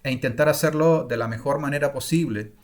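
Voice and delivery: usual speaking voice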